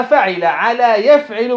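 فعل as fa'ila, يفعل as yaf'ilu; kasra on the middle. Shown as a demonstration. Teacher flagging the sixth pattern, يفعل with a clear i. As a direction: say يفعل as yaf'ilu, with i after the 'ayn. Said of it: "بالكسر".

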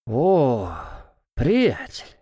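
put-on slurring drunk buddy; drunk frat bro. Drunk guy greets.

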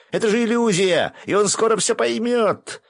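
loud, very high sustained yell; Gendzo shouting. A narrator's reading. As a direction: yell the warning at the top of the range. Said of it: "закричал Гензо". (высокий громкий крик)

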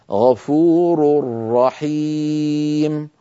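very slow "غفور رحيم", tanween merged into a doubled ra; recited with idgham. Shown as a demonstration. Teacher reciting the tanween example very slowly with merged nun. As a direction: say "غفور رحيم" as "ghafurur-rahim", no n. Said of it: "في الراء من رحيم".

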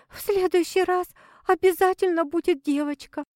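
high pleading wife voice. A narrator's reading. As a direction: beg him, voice up high.

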